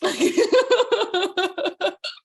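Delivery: high, loud giggling fit; high burst of laughter. Real, not acted. Laughing at herself while telling friends the story, high loud laugh. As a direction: let the laugh break out high and long. Laughs at her own reaction.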